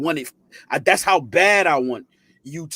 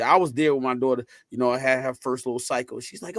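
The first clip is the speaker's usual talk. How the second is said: normal talking voice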